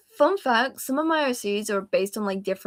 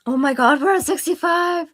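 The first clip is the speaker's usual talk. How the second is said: excited; 8 semitones higher, 3 dB louder than usual. Real, not acted. Excited about count reaching 65.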